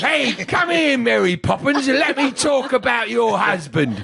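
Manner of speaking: high, drawn-out put-on British accent; mock British accent. Comic teasing her bad impression of him, high mock British accent. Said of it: "my accent becomes British".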